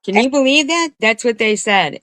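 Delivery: high-pitched, disbelieving outburst; incredulous. Real, not acted. Streamer reacting to what they said about her, incredulous. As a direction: voice jumps up in disbelief.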